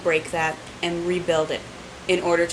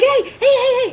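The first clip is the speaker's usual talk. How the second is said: much higher, louder hey hey yell; 7 dB louder than usual. Retold or imitated. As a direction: bark it across the room. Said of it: "And he yells at them".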